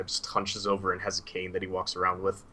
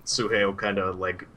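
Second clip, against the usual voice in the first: ordinary talk, nothing marked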